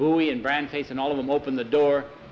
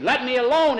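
high, loud protest; defiant protest; 10 semitones higher, 5 dB louder than usual. Acted. Hugo pulling free of Jackie, defiant shout. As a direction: shake someone off with a raised cry.

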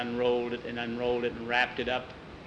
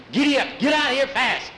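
high, loud shout to the horse; urgent shout; 11 semitones higher, 6 dB louder than usual. Acted. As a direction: yell to get going in a panic. Frightened man whips horse away.

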